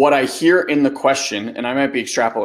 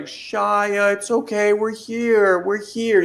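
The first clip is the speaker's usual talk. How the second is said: comforting sister; 9 semitones higher, slower than usual. Retold or imitated. Sister rubbing Shia's back, high and soothing. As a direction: soothe a crying brother gently. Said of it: "rubbing his back and was like".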